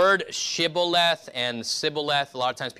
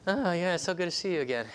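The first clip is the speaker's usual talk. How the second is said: fake friendliness; 6 semitones higher than usual. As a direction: fake bright greeting pitched up high. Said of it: "when people fake it".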